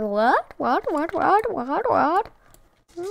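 rapid-fire rising questions; mock puzzled replies. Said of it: "She responds with".

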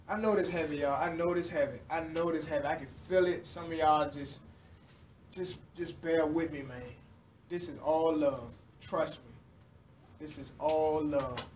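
soft, low reassurance; calm them gently, quietly; tender, quiet comfort to the room; reassuring. Real, not acted.

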